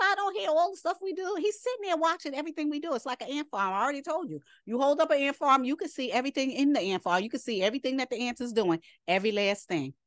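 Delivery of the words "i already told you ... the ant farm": ordinary talk, nothing marked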